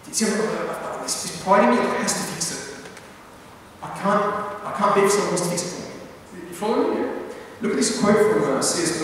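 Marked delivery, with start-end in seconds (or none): none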